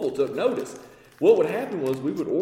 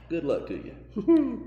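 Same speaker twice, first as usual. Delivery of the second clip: dismissive; 7 semitones higher, much slower than usual. Retold or imitated. Supplier dismissing them.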